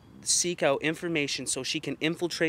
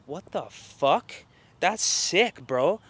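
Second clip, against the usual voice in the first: disgusted disbelief; 3 semitones higher, 3 dB louder, much slower than usual. Retold or imitated. Retelling his reaction to her marriage-fixing claim, appalled and louder. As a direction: recoil in disgust at what she just said.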